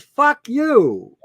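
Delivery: loud, blunt fuck you; defiant shout. Retold or imitated. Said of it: "They said".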